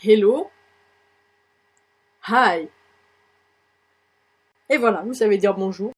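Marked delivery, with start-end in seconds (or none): 0.0-0.5 loud hello, rising at the end; loud, lifted teaching hello; repeat-after-me model. Shown as a demonstration. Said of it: "Vous allez répéter après moi". 2.3-2.7 drawn-out "Hi" with aspirated h; isolated, stretched teaching "Hi"; repeat-after-me model. Shown as a demonstration. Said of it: "Vous allez répéter après moi".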